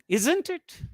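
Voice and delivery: "isn't it" jumping up high; question tag rise. Shown as a demonstration. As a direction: swing ISN'T IT sharply upward, seeking information. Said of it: "question text seeking information".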